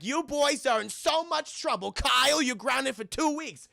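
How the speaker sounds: high-pitched stern sentencing; ground them as an imitated angry parent; mock parental scolding. Acted parent grounding the boys.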